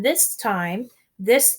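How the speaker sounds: ordinary delivery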